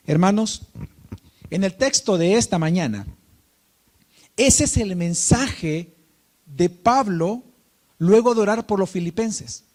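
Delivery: loud, emphatic sermon voice; preaching. Acted. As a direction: preach it out to the congregation, full force. Preaching from the text.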